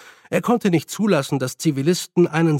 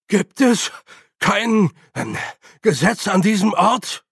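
hoch und laut rufen; empört in den Saal rufen; 6 semitones higher, 4 dB louder than usual. Acted. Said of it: "rief Staulich". (Staulich ruft empört, höher und lauter)

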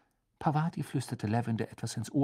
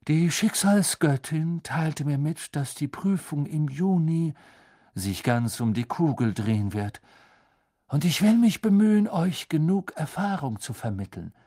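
solemn, higher-voiced fortune teller; mystic seer; 4 semitones higher, 4 dB louder than usual. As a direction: announce fate like an otherworldly seer. Trelawney voiced by narrator.

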